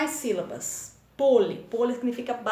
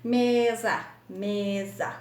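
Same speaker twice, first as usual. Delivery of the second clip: ME - SA, each syllable held apart; teacher sounding out ME-SA; split into syllables; much slower than usual. Shown as a demonstration. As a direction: say mesa as two clapped beats, ME, SA. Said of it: "Bate palminha duas vezes".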